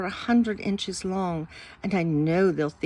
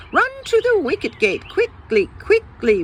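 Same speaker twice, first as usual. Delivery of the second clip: much higher, louder call to hurry; king barking at his chancellor to run; King Hilary ordering the chancellor to the gate, high and loud; urgent royal command; 11 semitones higher, 5 dB louder than usual. Acted.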